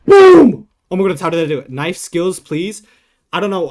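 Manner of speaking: triumphant shout, then loud excited chatter; yelling after a clutch win; hyped gamer bro. Real, not acted. Big win during live game.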